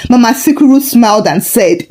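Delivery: usual speaking voice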